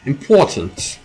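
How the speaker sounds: a lightly put-on British accent on Important!. Streamer mid-game hams up a British accent. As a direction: clip Important! the British way, as a mock posh exclamation. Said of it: "Did you like my British, British accent there?".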